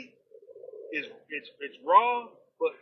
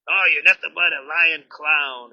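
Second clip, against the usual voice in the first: mock ranting insult; 4 semitones lower, 10 dB louder than usual.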